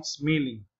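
"smiling" with ee in the first syllable, "smee-ling"; wrong pronunciation. Shown as a demonstration. English teacher warns students off a misreading, wrong vowel. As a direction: say "smiling" as if spelt "smeeling". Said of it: "no smiling".

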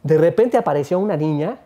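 ordinary delivery

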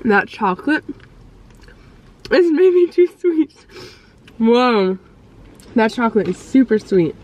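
chewing while talking; talking through a bite; mouth full of macaron. Real, not acted. Seen 0: Hand covers her chewing mouth, then she keeps chewing mid-bite.

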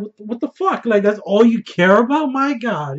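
high, stunned disbelief; a hint of Jon gasping at her greed; incredulous Jon. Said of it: "Jon's just looking at her like".

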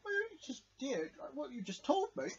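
normal talking voice

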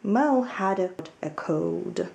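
put-on lively storybook reading for children; story narration. A narrator's reading. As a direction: read it like a picture book to little ones. Teacher reads children's story aloud.

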